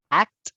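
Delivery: "Act" said crisply in English, model for the student; teacher drilling present tense; English model word. Shown as a demonstration. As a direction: say "Act" clearly for the learner to repeat. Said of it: "vamos a ir uno por uno".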